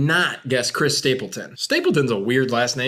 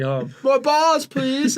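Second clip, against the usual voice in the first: very high, slow pleading squeak; whiny kid voice; 11 semitones higher, much slower than usual. Retold or imitated. Kid stuffed with meatballs pleading in a very high voice. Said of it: "you sound like".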